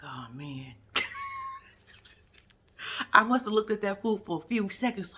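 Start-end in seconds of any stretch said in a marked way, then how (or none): 0.0-0.7 very soft, low; soft low craving sigh; longing murmur. Retold or imitated. Longing at food on TV. 0.9-1.6 very high giggling squeal; shrieking giggle; high squeal laugh. Real, not acted.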